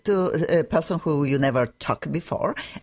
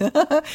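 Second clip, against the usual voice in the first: high, delighted laughter; laugh, tickled by the joke; 11 semitones higher than usual. Real, not acted.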